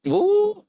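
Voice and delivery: sudden high squeal. Real, not acted. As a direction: yelp as if catching himself. Nearly slipping into accent.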